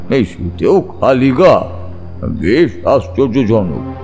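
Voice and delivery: usual speaking voice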